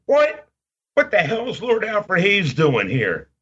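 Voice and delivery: high-pitched retold double take; astonished. Fan recalling spotting a wrestler in the mall, astonished. Retelling his astonished reaction.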